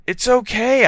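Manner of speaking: high, loud reassurance; high consoling cry. A narrator's reading. Girl trying to console crying Vampire, high loud voice. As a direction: pitch it way up to console.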